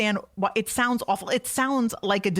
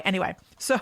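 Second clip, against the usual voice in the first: ordinary delivery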